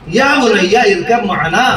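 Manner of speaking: slow recitation in a changed voice; Quranic recitation. Shown as a demonstration. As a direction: chant the verse slowly. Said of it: "قرآن کیا پڑھتا ہے". (آہستہ تلاوت)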